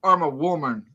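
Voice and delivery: loud, high offended protest; indignant. Retold or imitated. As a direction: insist hard, affronted. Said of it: "And, and she".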